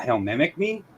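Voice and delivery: normal talking voice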